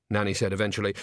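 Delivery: usual speaking voice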